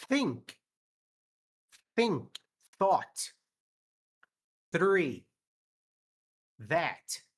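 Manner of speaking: think, Thought, Three, That with tongue touching the finger; TH drill words; th sound. Shown as a demonstration. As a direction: blow over the tongue for each TH. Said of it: "o som do T H".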